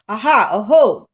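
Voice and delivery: loud, eager cry of discovery; excited exclamation. Acted. Gruffalo's child spots a trail in the snow, loud excited cry. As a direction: call out thrilled at a find. Child finds claw marks.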